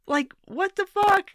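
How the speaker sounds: high, slow, incredulous outburst; exasperated disbelief. Real, not acted. Exasperated reaction to Bernie's defense.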